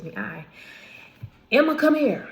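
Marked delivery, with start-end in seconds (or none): 1.5-2.3 called out sharp and loud, high; stern call. Retold or imitated. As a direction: summon the child from across the house. Mom calling child from another room.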